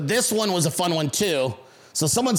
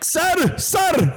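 calling out; 11 semitones higher than usual. Retold or imitated. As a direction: shout to get his attention. Driver calling after him.

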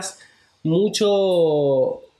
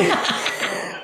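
hiccup caught mid-laugh; 3 dB quieter than usual. Real, not acted. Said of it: "¿Por qué tan fuerte?". (hipo con risa)

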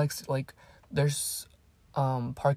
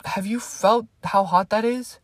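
outraged retort; 6 semitones higher, 7 dB louder than usual. Retold or imitated. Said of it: "I'm like".

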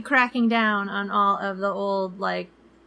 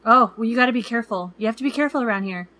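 fast, louder cautious warning; warning local; 3 semitones higher, 4 dB louder, faster than usual. Retold or imitated. Stranger warning the couple to be careful, fast. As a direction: rush the warning. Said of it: "he goes".

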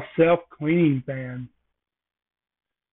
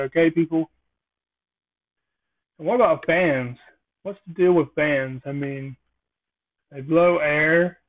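normal talking voice

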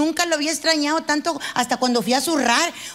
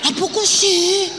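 mock innocent; 4 semitones higher, slower than usual. Men pretend innocence.

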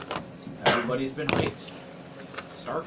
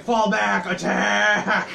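barked commands; 8 semitones higher, 5 dB louder, much slower than usual. Retold or imitated. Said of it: "He'd be like".

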